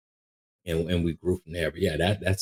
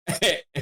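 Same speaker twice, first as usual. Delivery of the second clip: burst of laughter; 10 semitones higher than usual. Real, not acted. Amused at the memory.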